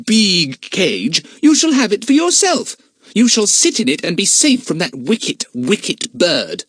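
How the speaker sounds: slow, loud, high mock threat; dramatic mocking. Jack teasing Gus, dramatic mock threat. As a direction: ham it up to frighten. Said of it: "he said most dramatically".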